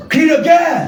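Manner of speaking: high shouted call to the crowd; rally them with a high shout; war cry call. Acted. Preacher calling the war cry, high shout.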